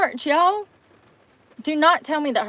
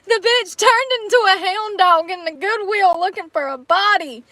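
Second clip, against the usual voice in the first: laughing; 8 semitones higher, 3 dB louder than usual. Real, not acted. Laughing disbelief.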